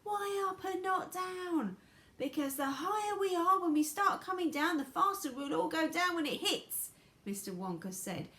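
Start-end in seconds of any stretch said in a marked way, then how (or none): 0.0-1.8 high, drawn-out acted protest; shouting. A narrator's reading. Everyone shouts at Wonka at once, high and protesting. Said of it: "they all shouted at once". 2.2-6.9 high, loud, excited character voice; Mister Wonka. Acted. Wonka explains the climb over the noise, high and loud.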